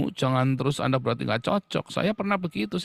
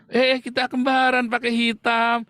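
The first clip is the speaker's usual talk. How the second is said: giddy couple; 8 semitones higher, 5 dB louder than usual. Retold or imitated. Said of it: "Anda sama dia".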